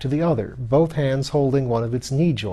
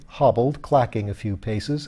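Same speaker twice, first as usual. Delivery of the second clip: plain reading voice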